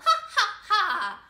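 loud, high, forced ha-ha-ha; hammed-up laugh for kids to copy; mock laugh. Shown as a demonstration. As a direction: belt out a pretend belly laugh. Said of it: "Everyone laugh".

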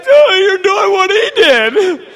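high, loud, drawn-out cry; imitated tearful daughter protesting; mock wailing protest. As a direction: wail it back at mom, hammed-up. Daughter wails back at scolding mother.

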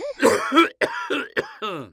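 a beadle's dignified cough; pompous cough. Acted. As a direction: cough with self-importance. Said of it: "Mister Bumble coughed".

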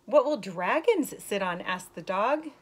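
put-on slow, lilting read-aloud voice for kids; story reading. Teacher reading a rhyming book to young kids, lilting. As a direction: read the rhyme brightly to the class. Reads picture book to children.

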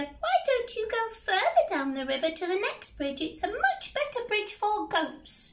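high squeaky spider voice, coaxing; sly little spider. Acted. Spider coaxes troll to the next bridge, high squeak. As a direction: talk the troll away in a tiny squeak.

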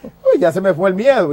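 speaking through a laugh. Real, not acted. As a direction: laugh as the fear goes. Laughing while telling.

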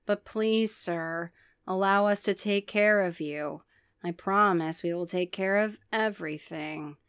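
soft, placating ticket monster; deadpan monster greeter. Acted. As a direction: soothe the customer, calm and polite. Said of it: "That should be his accent".

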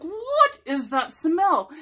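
high, slow, drawn-out what is that smell; mock-horrified sniff; disgusted surprise. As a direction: recoil at a bad smell in the house. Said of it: "I'm like".

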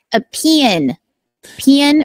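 Rock Hill accent. Shown as a demonstration. Guest demonstrates her home dialect, Rock Hill accent. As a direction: stretch pen into two Rock Hill syllables. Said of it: "the Rock Hill accent, it's like".

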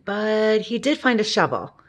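ordinary delivery, nothing marked

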